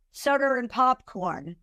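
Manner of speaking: "soda and popcorn" with an R joining "soda" to "and"; linking R. Shown as a demonstration. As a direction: slip an R in after "soda": "soder and". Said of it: "R is put in as a transition consonant".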